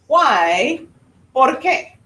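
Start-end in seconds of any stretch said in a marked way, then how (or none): 1.3-2.0 "Por qué" lifted high, rising at the end; questioning intonation. Shown as a demonstration. Teacher models the Spanish word for why, questioning rise on "Por qué". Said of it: "indicates the question".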